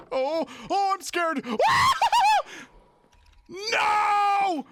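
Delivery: high, loud panicked yelps with a laugh; real scared yelling. Real, not acted. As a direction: flee yelling in fright.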